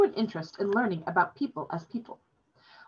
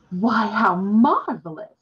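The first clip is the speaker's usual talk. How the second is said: slow, louder, drawn-out cry of wonder; delighted exclamation; 3 semitones higher, 7 dB louder, much slower than usual. Acted. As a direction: savour each word with delight. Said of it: "the demoness exclaimed".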